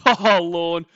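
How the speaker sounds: amused laughing exclamation. Real, not acted. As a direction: burst out laughing at the name. Laughing reaction.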